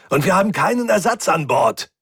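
fed-up complaint under time pressure; harried and pissed-off. A narrator's reading. Mechanic has no spare part, harried and pissed-off. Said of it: "zu gleichen Teilen gehetzt wie angepisst".

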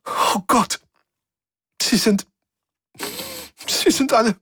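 hohes, weinendes Schluchzen; weinen, die Stimme bricht weg; sobbing. A narrator's reading. Svensson breaks down crying. (schluchzend, deutlich höher)

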